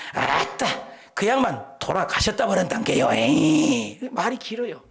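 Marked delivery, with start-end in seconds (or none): 0.1-3.1 Jeolla dialect. Retold or imitated. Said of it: "전라도 사람들은 죽음에 대해서도 굉장히 길어요".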